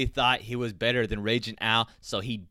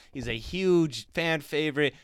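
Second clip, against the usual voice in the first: ordinary delivery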